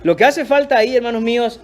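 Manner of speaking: ordinary talk, nothing marked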